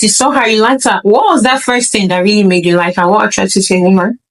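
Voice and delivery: ordinary delivery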